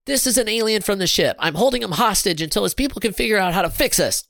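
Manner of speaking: high-pitched, assured announcement; confident. A narrator's reading. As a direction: announce your prize capture with confidence. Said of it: "Sarah said confidently".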